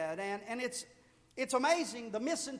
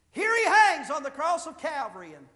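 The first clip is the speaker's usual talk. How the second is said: ordinary talk, nothing marked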